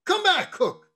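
high, sudden summons; calling back. Acted. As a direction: call the cook back sharply. Mate calls departing cook back.

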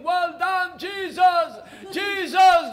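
very high, very loud cheering; crowd shouting. Acted. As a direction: cheer him like a stadium crowd. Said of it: "everybody was shouting".

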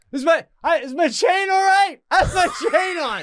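high, loud panicked yell; yelling from the machine. Retold or imitated. Retelling yelling from the MRI, high and loud with a laugh. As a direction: yell for help stuck inside. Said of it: "I'm yelling".

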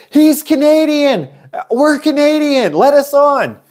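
very high, loud, stretched yells; excited shouting. Retold or imitated. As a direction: beg the sailors loudly to let them aboard. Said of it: "we were shouting at them".

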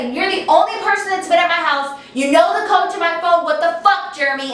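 indignant accusation, acted at normal volume; going off. Friend confronts boyfriend as only suspect, acted anger. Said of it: "going off, right?".

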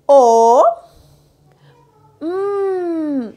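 loud "O" then a hummed "Mm"; sounding out. Shown as a demonstration. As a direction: call the O, then hum. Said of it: "juntar los sonidos". (vocal O y sonido m)

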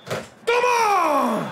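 high, loud cry of victory; triumphant roar. Real, not acted. Streamer celebrating the winning goal, full roar. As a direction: let it rip like a winning goal.

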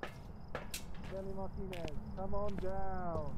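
slow, drawn-out game show announcer; game show host. Acted. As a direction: call the contestant down like a TV host. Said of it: "You sound like a game show host".

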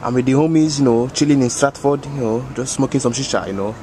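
put-on African-accented uncle voice, unhurried. Uncle bragging to the homies, African accent. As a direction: play the boastful African uncle.

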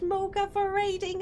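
normal talking voice

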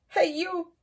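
high, loud, cheeky challenge; grouchy ladybug voice. Acted. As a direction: pick a fight in a squeaky bug voice. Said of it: "said the grouchy ladybug".

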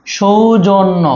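"সৌজন্য" slowly, syllable by syllable; slow sounding-out. Shown as a demonstration. Teacher dictating a spelling, "সৌজন্য" slowly sounded out. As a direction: stretch out each syllable of "সৌজন্য". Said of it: "জফলা হবে".

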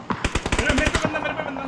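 high, loud panicked callout; alarmed shout. Real, not acted. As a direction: yell for help, panicked. Enemy rushing him.

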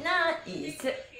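ordinary talk, nothing marked

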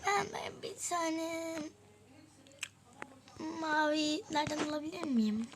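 ordinary talk

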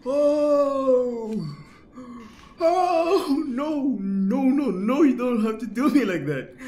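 high, loud panicked whimpering; scared wailing. Real, not acted. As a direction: plead and wail in real fright. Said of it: "I'm scared".

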